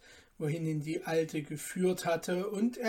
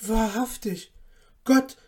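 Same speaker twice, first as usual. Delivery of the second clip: higher, distressed talking to oneself; troubled; 4 semitones higher, 6 dB louder than usual. A narrator's reading. Son fearing his mother has gone mad, troubled. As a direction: worry aloud to yourself. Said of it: "sprach der Kleine bekümmert zu sich".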